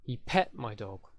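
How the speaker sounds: "pet" stressed and drawn out slowly. Shown as a demonstration. Teacher shows stress changing focus, stress on "pet". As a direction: punch the verb "pet" as the focus. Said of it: "four words in a sentence that could be stressed".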